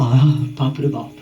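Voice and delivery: low, slow put-on exclamation; mimicked gawker. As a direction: draw out a mock-awed exclamation, low. Mimicking gawkers admiring someone. (देखने वाले की नकल, धीमी नीची आवाज़ में)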